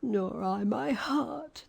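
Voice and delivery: slow, sad tin man voice; Tin Woodman. A narrator's reading. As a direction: say it slowly and heavily.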